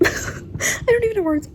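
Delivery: high, slow, overwhelmed; high flustered wail. Real, not acted. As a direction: sound lost for words. Flustered by ending.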